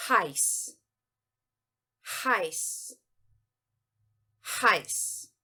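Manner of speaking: heiß said as "hice", one syllable; German heiß. Shown as a demonstration. Teacher modeling heiß for Portuguese students, clear German. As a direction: model heiß slowly for learners. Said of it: "Trata-se aqui da palavra".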